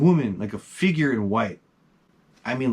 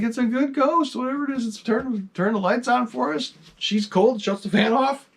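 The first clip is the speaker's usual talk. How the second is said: much higher, eager protest; excitedly defending the ghost to his mother and sister; excited pleading; 13 semitones higher than usual. Retold or imitated. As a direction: talk them round, wide-eyed and keen. Pleading with frightened family.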